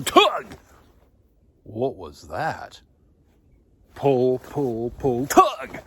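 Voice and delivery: rising excitement from a hushed what was that to high, loud pull pull cries; mounting thrill, loud and high; excited hauling. Acted. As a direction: chant the hauling as the net grows heavy. Acting out the hauling of fish.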